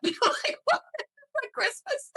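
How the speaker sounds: can't believe what dad said; incredulous. Real, not acted. Daughter laughing at dad's house-cut rule, incredulous.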